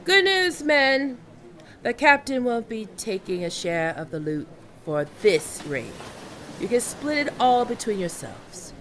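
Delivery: loud, high announcement to the men; rousing captain's call; calling to the crew. Acted. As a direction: shout the good news across the deck.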